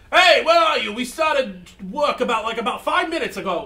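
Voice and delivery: loud and high, calling a late worker; annoyed boss. Acted. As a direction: bark it like an impatient boss. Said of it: "And then she goes like".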